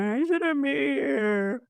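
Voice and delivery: high, drawn-out mock wail; mock crying. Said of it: "Like, just crying myself, going".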